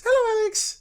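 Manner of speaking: very high, tiny squeaky man. Retold or imitated. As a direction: say it as a teeny miniature person. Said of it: "In a tiny voice".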